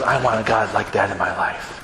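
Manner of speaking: very low, understated tearful plea; subdued, earnest retold longing. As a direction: lightly imitate a big man breaking down. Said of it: "broke down in tears and goes".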